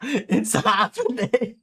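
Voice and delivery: laughing, high and slow; gleeful laugh. Real, not acted. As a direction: let the glee spill over with a laugh. Player laughing gleefully at chaos.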